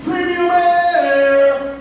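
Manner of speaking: salesy hype. Retold or imitated. Hyping his car to salvage yard.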